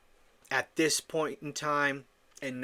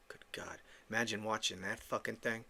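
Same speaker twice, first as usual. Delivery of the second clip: hushed, appalled whisper under the breath; softer disgusted muttered aside; 5 semitones lower, 12 dB quieter than usual. Real, not acted. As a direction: whisper it like you can't believe it. Disgusted aside about her show.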